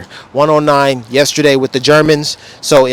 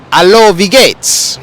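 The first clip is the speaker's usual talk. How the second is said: higher, slow, drawn-out greeting; showy German greeting; 5 semitones higher, 4 dB louder, slower than usual. Retold or imitated. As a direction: hit them with a proud, stretched-out hello. Acts out his German greeting to riders.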